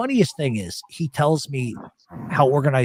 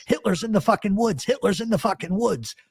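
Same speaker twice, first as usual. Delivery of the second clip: higher, hammed-up frightened cry; mock panic; 7 semitones higher than usual. Elio fleeing through the woods, mock panic. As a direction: retell a friend yelling in fright. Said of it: "He goes running past me going".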